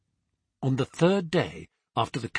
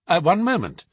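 raised, brisk Holmes voice; Holmes interposing; 7 semitones higher, 7 dB louder than usual. A narrator's reading. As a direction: cut in politely but firmly. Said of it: "Holmes interposed".

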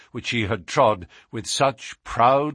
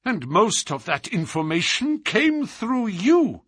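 high, agitated outburst; appalled captive, pitch raised; dismayed cry; 10 semitones higher than usual. A narrator's reading. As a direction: cry out as the truth sinks in. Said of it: "he cried".

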